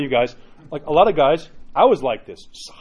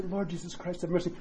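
hushed prayer; 10 dB quieter than usual. Shown as a demonstration. Modelling the Jesus prayer.

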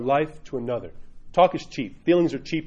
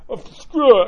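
higher, louder burst of mock exasperation; fed-up giving up; 7 semitones higher, 7 dB louder, slower than usual. Pity-party goer giving up, exasperated. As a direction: throw it all in, loudly.

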